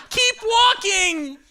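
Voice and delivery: very high, drawn-out holler; stretched, high-pitched acted urging; urging shout. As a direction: yell it slowly to a straggling friend. Urging a drunk friend home at night.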